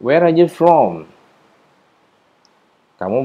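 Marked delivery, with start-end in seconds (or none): 0.0-1.1 slow model question. Shown as a demonstration. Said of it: "pertanyaan seperti ini".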